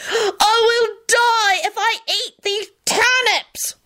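very high, drawn-out tragic wail; melodramatic. A narrator's reading. Boy claiming turnips will kill him, melodramatic. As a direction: ham it up, stretching every word high. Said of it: "Teddy shouted melodramatically".

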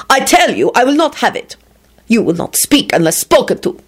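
high, indignant command; angry. A narrator's reading. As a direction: lay down the law angrily. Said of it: "Radu spat out angrily".

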